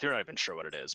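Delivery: ordinary delivery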